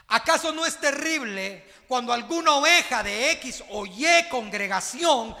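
loud, fervent sermon delivery; full-force preaching. Acted. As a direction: preach it hard to the flock. Preaching to the congregation. (predicando con fuerza a la iglesia)

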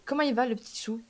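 ordinary talk, nothing marked

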